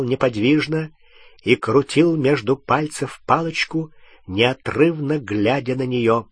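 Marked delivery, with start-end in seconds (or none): none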